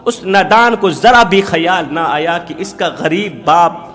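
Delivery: usual speaking voice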